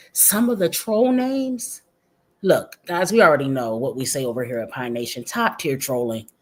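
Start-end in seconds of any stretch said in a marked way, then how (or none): none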